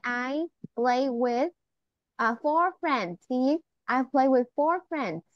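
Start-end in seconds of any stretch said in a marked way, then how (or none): 0.1-3.2 slow, careful model sentence. Shown as a demonstration. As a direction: spell it out slowly for a child. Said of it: "you can hear say".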